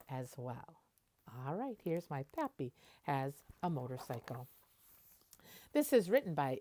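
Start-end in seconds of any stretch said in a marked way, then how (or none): none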